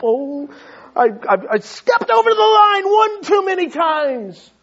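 high, loud panicky stammer; panicked believer. Retold or imitated. Imitating fearful believers expecting punishment, mock panic. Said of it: "People think".

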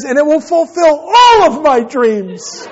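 high, slow, grandly drawn-out declaration; hammed-up imitation of a passionate child; passionate, charismatic. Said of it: "very passionate".

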